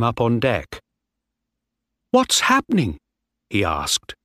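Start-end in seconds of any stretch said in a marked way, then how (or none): 2.1-3.0 high, frightened question; afraid. Acted. Young sailor waking to mutiny, high frightened call. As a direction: call out in alarm. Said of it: "He was afraid".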